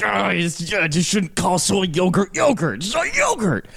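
hammed-up outraged stand-up impression. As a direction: sputter an exasperated rant, pitch climbing to a squawk.

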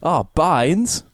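high mock Aussie "Oh, Bones"; Aussie accent parody; mock Australian. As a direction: repeat it the way you did to your girlfriend. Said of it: "I was repeating to my girlfriend".

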